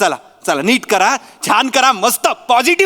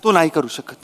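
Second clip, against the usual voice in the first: coaxing persuasion; 7 semitones lower than usual. Real, not acted. Coaxing actress quietly.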